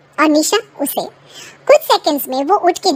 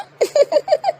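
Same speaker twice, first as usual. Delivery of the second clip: put-on high boy giggle; child's giggle; 10 semitones higher than usual. Child laughs.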